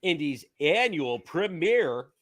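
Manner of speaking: big hammed-up show announcer; cheesy announcer voice. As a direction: read it like a promo, punching the key words. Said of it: "announcer voice".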